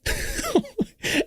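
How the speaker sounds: high disbelieving laugh; high burst of laughter; incredulous laugh. Real, not acted. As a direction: laugh at how absurd it is. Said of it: "I'm laughing because it's so preposterous".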